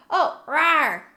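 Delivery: playful cat rawr; cutesy little roar; cute anime streamer. Acted.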